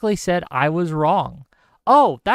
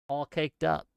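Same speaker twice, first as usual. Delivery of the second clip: ordinary talk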